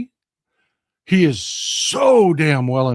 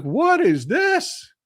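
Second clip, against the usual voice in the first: baffled; 9 semitones higher, much slower than usual. Retold or imitated. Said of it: "I was like".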